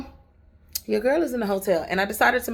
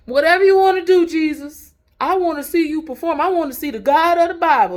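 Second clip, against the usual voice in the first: high, loud daring cry to Jesus; bold loud prayer; 10 semitones higher, 11 dB louder than usual. Retold or imitated. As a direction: belt it out like a fearless dare. Voicing her bold prayer to Jesus.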